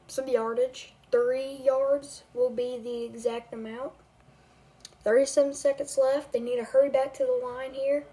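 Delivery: game commentator calling the drive; amateur play-by-play over a video game; live play-by-play. Acted. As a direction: call each snap like a broadcaster.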